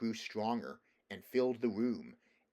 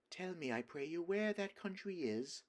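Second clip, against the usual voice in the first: sweet witch voice; 8 semitones higher than usual. A narrator's reading.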